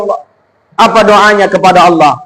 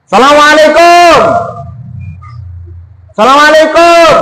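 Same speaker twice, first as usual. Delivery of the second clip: calling greeting; 6 semitones higher than usual. Acted. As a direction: call out at the gate of hell. Prophet knocks on hell's gate. (salam dipanggil keras dan tinggi)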